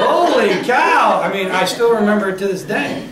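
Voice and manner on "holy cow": slow, high, laughing exclamation; shocked laugh; stunned. Retold or imitated. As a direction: drag it out in disbelief. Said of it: "And I'm going".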